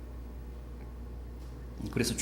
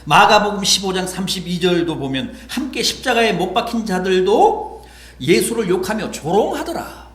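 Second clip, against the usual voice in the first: loud, raised scripture citation; fervent preaching; 9 semitones higher, 10 dB louder than usual. Acted. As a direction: declaim the verse from the pulpit. Preaching at full force.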